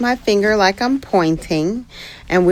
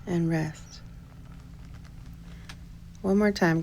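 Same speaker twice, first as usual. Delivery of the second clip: guided breathing; 8 dB quieter than usual. Shown as a demonstration. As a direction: count the breaths calmly and quietly. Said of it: "leads a breathing exercise".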